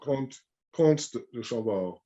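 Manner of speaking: Comte de Chambord, nasal vowel, no M, slow; no M sound. Shown as a demonstration. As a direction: say Comte without the M, slowly. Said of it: "you don't really pronounce the M".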